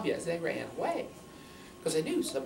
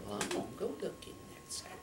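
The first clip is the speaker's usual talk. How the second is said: hunter's voice; 10 dB quieter, faster than usual. Acted. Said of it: "And the hunter said".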